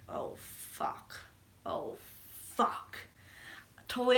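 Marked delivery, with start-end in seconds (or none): 0.1-3.0 whispered, breathy "oh, fuck"; horrified whisper. Real, not acted. Viewer seeing the plan collapse, horrified whisper. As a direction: barely voice it, stunned. Viewer's dread as Star-Lord turns.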